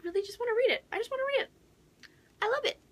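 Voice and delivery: very high pleading gush; squealing eagerness. Real, not acted. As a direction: squeak it like you can't wait. Squealing longing.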